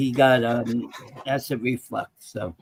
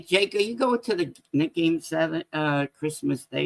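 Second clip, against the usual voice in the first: ordinary talk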